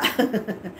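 short, low chuckle; dismissive laugh. Real, not acted. Streamer dismissing a rival who did nothing, scornful chuckle. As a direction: laugh it off, softly.

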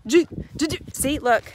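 stuttering start. Real, not acted. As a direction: stumble over the first word. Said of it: "I had a speech impediment".

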